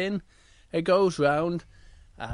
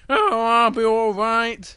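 high, loud, drawn-out cub; Little Bear voice; 5 semitones higher, 7 dB louder, slower than usual. Acted. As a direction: shrug off the warning, stretched and high. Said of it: "says to Little Bear".

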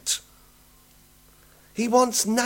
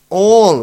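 all hit hard and much louder; stress on all; 2 semitones lower, 11 dB louder than usual. Shown as a demonstration. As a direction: punch the word all. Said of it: "we should underline that".

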